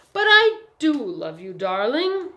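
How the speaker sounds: high, loud, heartfelt insistence; emphatic declaration of love; earnest. A narrator's reading. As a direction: plead it earnestly, leaning on DO. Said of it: "the young woman said earnestly".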